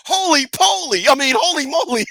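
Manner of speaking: high, hammed-up gasp of surprise; a shouted, high mock holy moly; mock shocked exclamation. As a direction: squeal it out like a startled man. Mock exclamation in imagined scene.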